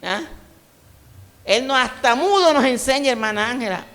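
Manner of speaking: normal talking voice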